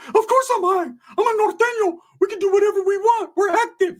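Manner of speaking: high as a kite, squeaky bragging; very high-pitched wired cousin impression; Ricky. Wired cousin boasting to narrator, squeaky high voice. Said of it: "Bro, are you high as fuck?".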